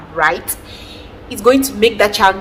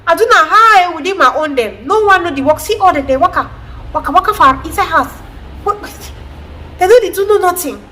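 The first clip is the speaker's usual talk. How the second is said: complaining mother; 8 semitones higher than usual. Retold or imitated. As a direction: rant like a mother who undervalues the kid. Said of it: "you hear some mother saying".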